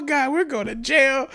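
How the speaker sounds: high, hammed-up whimper; mock-tearful despair, high-pitched; panicked wail. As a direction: imitate a friend dreading arrest. Buddy fears jail, acted out.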